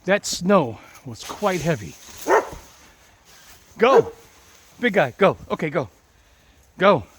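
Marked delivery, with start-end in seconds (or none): 3.8-7.0 loud firm command to go; commanding the dog. Real, not acted. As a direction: order the dog out.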